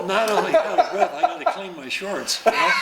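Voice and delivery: laughing through the words. Real, not acted. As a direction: chuckle while you say it. Laughing at his own joke.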